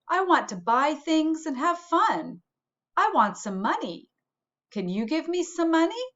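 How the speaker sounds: put-on a touch of a child begging for money; eager boy. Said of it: "said the boy".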